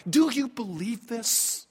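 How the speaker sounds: ordinary talk, nothing marked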